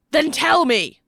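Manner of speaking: loud, high, forceful order; furious yell. A narrator's reading. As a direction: yell it as a final demand. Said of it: "Matthew yelled".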